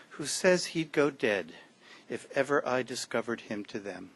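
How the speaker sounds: lightly heightened poetry reading; poem reading. Acted. Poet reads his poem to the audience, measured and lightly heightened. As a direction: read the lines out with care to the audience.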